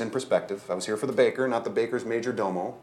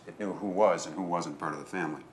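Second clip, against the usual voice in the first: plain reading voice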